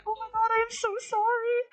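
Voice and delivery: high, fast, shocked apology. Retold or imitated. Apologizing to boyfriend after biting him, high and mortified. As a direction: gasp it out, mortified.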